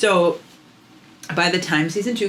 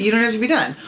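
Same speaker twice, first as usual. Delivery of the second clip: usual speaking voice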